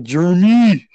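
loud imitated parental call; dad calling out. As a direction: call the kid's name out like a stern dad. Dad calls out to son.

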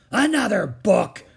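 high, loud outraged bellow; roaring pirate. A narrator's reading. Captain Bones at Jack's book, outraged roar. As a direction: roar in disgust. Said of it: "roared Captain Bones".

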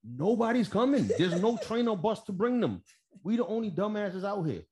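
high-pitched, exasperated protest. Retold or imitated. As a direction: voice climbs in comic disbelief. Retells his exasperation at pointless orders.

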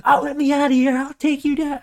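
squeaky cartoon tough-guy bluster; Muscle Man's voice. Trapped Muscle Man begging and threatening, very high. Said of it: "Muscle Man's like".

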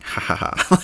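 high, self-amused snicker; imitated snicker. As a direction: snicker at your own private joke. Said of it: "I'll just kinda snicker".

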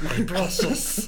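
Gollum voice. Pretend. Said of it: "a pretty decent impression of Gollum".

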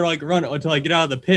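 normal talking voice

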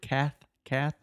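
"cath, cath" with a clear th at the end; th sound demo. Shown as a demonstration. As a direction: bite the th on "cath, cath". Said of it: "put a T H sound".

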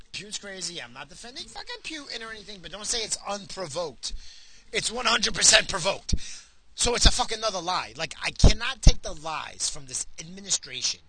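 ordinary delivery

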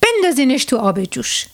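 mildly angry master ordering servants; understated storybook anger, starting high. A narrator's reading. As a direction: give the order with a touch of temper. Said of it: "ارباب با عصبانیت دستور داد".

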